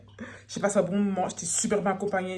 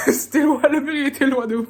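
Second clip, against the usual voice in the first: laughing; 5 semitones higher, 6 dB louder than usual. Real, not acted. Bumps into wall, laughing.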